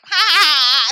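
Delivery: very high, loud fake laugh; hammed-up imitation of her cackle; mock cackling. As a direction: shriek with obnoxious laughter. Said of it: "she's still like".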